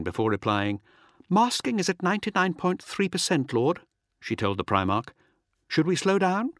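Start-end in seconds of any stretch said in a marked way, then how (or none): 1.3-3.8 high-pitched female officer, composed report; raised voice, calm and efficient, lifting on the address; quick but calm. A narrator's reading. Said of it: "her words quick but calm". 5.7-6.5 high-pitched, composed query; higher voice of a bridge officer asking; calm female officer. A narrator's reading. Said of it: "her words quick but calm".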